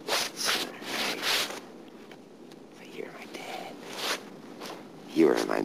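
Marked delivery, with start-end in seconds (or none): 0.1-3.7 slow soft whisper to his dad; hushed whisper at the grave. Real, not acted. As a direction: barely breathe the words. Whispering to dead father.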